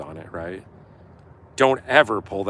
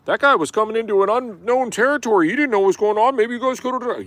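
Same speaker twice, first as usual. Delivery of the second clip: much higher, louder reasonable self; voice of reason; 13 semitones higher, 8 dB louder than usual. Pretend. Telling himself to see the cop's side, raised voice. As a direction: play the calm self scolding you. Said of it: "I need to police myself and go".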